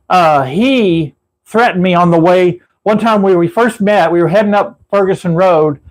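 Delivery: normal talking voice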